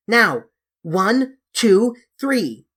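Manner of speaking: building to a loud shout on three; counted yell. Acted. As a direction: count them in, rising into a yell. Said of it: "You don't half yell".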